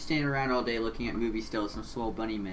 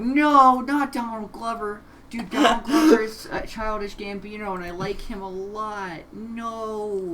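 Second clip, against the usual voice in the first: distraught; 8 semitones higher, 7 dB louder, slower than usual. Real, not acted. Character killed in game.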